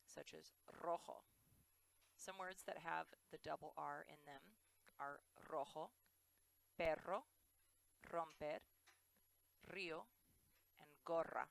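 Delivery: rojo, perro, gorra with a trilled R; rolled Spanish R. Shown as a demonstration. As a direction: roll every R hard. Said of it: "it's always the rolled R".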